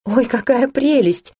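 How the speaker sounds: slow, high gush of delight; delighted gasp. A narrator's reading. Heroine gushing at the tiny dragon, slow and high. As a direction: coo over something adorable.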